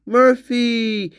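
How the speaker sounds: high, slow, drawn-out dog call; imitated calling out. Imitating what is heard on the tape, calling out. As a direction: draw the name out high, as a call. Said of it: "We hear".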